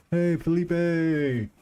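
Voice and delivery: high, loud call to a friend arriving. Real, not acted. Streamer greeting Felipe as he joins, calling out high and loud.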